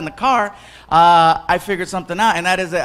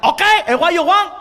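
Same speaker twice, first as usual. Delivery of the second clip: high Cuban waiter; 8 semitones higher than usual. Retold or imitated. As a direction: toss it up impatiently. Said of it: "the guy's like".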